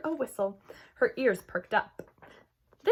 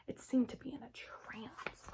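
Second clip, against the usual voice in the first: slow eerie whisper; 3 semitones higher, 11 dB quieter than usual. A narrator's reading. Narrator whispering about the snake's trance. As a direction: hush it like a trance.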